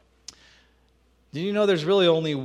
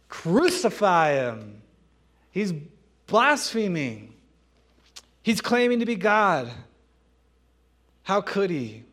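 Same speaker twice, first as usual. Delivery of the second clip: angry crowd; 4 semitones higher, 6 dB louder than usual. Acted. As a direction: rouse it like an outraged mob.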